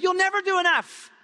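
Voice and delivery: usual speaking voice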